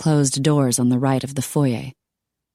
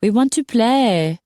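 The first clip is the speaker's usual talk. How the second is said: put-on high, drawn-out French-accented whine; high, coaxing Frenchwoman with French accent; 9 semitones higher, 3 dB louder, slower than usual. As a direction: plead slowly in a French accent. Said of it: "the French accent continued".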